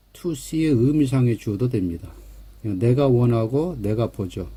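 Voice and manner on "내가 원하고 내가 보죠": ordinary talk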